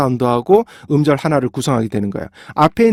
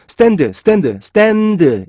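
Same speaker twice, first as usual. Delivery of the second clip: Koreanized stand, 스탠드, last one stretched; Korean accent; 5 semitones higher, much slower than usual. Shown as a demonstration. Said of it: "우리나라 사람들은요".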